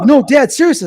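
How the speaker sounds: high, loud retold kid's plea; urgent plea. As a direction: insist to a dad that this is serious. Child pleading with dad in retold quarrel.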